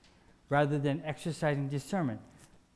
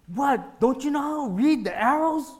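loud, high exasperated snap at another driver; high, loud mock outrage; mock irritated driver; 8 semitones higher, 7 dB louder than usual. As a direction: bark it like an annoyed driver in a parking lot. Acted rude driver in parking lot.

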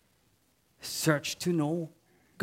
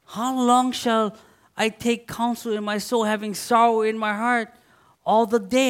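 lifted, louder scripture read in pleading tone; impassioned psalm reading; 5 semitones higher, 5 dB louder than usual. Acted. Preacher reading David's lament with rising passion.

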